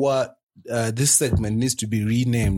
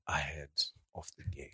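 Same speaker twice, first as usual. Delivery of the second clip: leaning in to whisper; 11 semitones lower, 18 dB quieter, faster than usual. Acted. Conspiratorial aside after "Psst.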